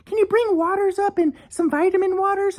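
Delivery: high falsetto imitation of her asking; mocking his ex. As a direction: whine the request in a high put-on voice. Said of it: "she messages me".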